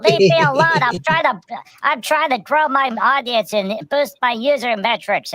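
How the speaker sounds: a hammed-up Dalek shooing them off. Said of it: "And Dalek's like".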